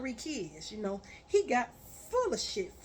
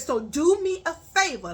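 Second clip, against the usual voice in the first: louder and faster, scolding; angry rant; 6 semitones higher, 8 dB louder than usual. Retold or imitated. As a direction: tear into him, raising your voice. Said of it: "I said".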